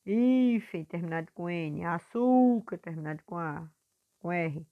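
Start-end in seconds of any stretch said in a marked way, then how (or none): 0.0-0.8 "Hífen" with a long, high HÍ; teacher drawing out HÍ in "Hífen"; stretched stressed syllable. Shown as a demonstration. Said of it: "puxando mais a sílaba forte". 1.8-2.8 "Açúcar" with ÇÚ held high and loud; teacher drawing out ÇÚ in "Açúcar"; stretched stressed syllable. Shown as a demonstration. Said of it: "puxando mais a sílaba forte".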